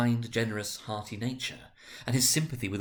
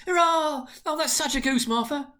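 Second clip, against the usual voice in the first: put-on much higher, louder childish hurrah; children cheering; 5 dB louder than usual. A narrator's reading. Small children cheering to their sister, high and loud. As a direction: squeal with delight over the goose.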